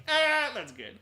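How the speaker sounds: high, loud mock cry of approval; imitated burst of audience enjoyment; theater audience reaction. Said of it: "All three of us in the theater were like".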